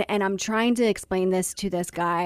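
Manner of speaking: ordinary delivery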